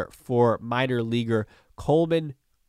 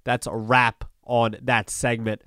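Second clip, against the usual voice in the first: ordinary talk, nothing marked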